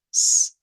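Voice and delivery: a voiceless, hissed s on its own; sibilant s. Shown as a demonstration. Teacher showing the alveolar sounds, sibilant s. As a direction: hold s as a pure hiss without voice. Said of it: "la S silbante".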